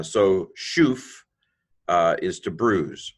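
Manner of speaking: usual speaking voice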